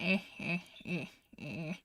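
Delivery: put-on low, soft wheezy old man's chuckle, lightly done; a hint of a creepy old man chuckling under his breath; imitated old man chuckle. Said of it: "that's how I imagine his chuckle".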